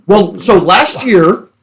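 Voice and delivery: ordinary talk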